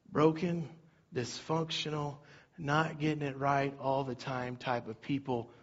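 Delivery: a hushed, earnest ending to the sermon; soft closing sermon. Acted. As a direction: bring it down for the close.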